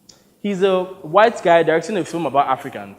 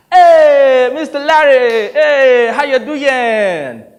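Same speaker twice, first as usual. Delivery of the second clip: loud, high, stretched hello; effusive greeting; 9 semitones higher, 11 dB louder, much slower than usual. Acted. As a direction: greet him big and slow like a showman. Sonny greets director effusively.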